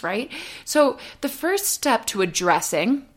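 usual speaking voice

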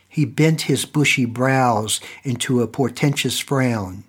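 plain reading voice

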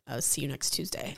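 low, hushed quiet sneer; sneering aside. Retold or imitated. Celebrity snubbing her nail artist, low and hushed. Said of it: "Cameron was like".

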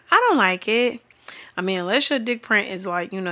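ordinary talk, nothing marked

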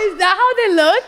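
high, loud, through helpless laughter; laughing shock. Real, not acted. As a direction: shriek the question while cracking up.

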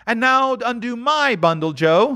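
high, fast and bold; brazen woman. Acted. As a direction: justify the theft, hard. Woman voiced.

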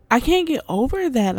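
ordinary delivery

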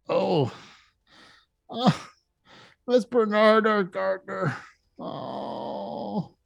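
recognising a friend among the bodies; shocked grief. Acted. Bartender recognises dead gardener.

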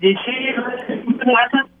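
breaking-up phone line; words dropping out on a bad cell connection; muted words. Real, not acted. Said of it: "You, you cut out there".

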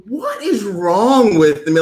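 high, slow, drawn-out disbelief; exasperated self-reproach. Retold or imitated. Storyteller shamed by a jumping kid, exasperated at himself. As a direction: wail it at yourself in mock despair.

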